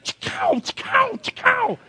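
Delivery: very high, playful rifle-fire noises; high mock gunshot sounds with a laugh; imitated gunshots.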